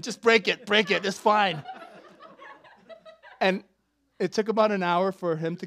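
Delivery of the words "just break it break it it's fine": louder and higher, laughing through it; mock brave husband. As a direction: play the tough husband for laughs. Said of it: "I'm like".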